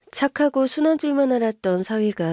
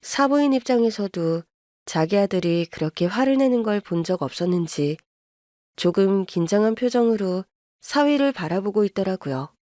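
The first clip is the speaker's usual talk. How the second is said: ordinary delivery, nothing marked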